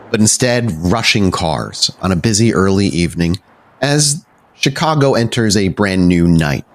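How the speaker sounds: normal talking voice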